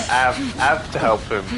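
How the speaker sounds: "Have" with the H dropped, Cockney style. Shown as a demonstration. Player correcting own imitation, "Have" said without its H. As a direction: say "Have" as 'ave, no H. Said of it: "There's no H".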